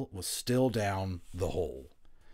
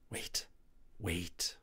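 softer breathed warning; 2 semitones lower, 8 dB quieter than usual. A narrator's reading. Patrick breathes a softer warning to Omri to wait. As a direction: hold your friend back in a breath. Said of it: "breathed Patrick".

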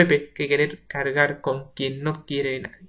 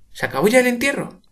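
much higher call; 10 semitones higher, 5 dB louder, faster than usual. Acted. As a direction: call across the stairs. Paca calling from door.

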